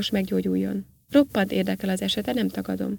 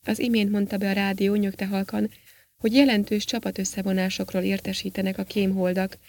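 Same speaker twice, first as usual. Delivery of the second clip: ordinary delivery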